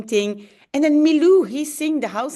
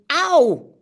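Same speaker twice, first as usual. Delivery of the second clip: mock cry of pain; 6 semitones higher, 5 dB louder than usual. Character hit by table.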